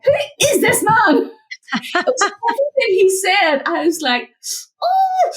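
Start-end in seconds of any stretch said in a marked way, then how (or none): 0.0-1.3 high, incredulous who is this man; mock outrage. Quoting her reaction to the coach, high and indignant. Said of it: "And I was just like". 4.8-5.3 a high, exasperated oh; mock rage. Retelling her rage at the coach's remark, a high indignant oh. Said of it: "so rage".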